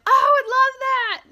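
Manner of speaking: high, loud, slow gush of delight. Real, not acted. Answering a viewer about the dogs meeting, high delighted gush. Friend's kid wants to meet dog.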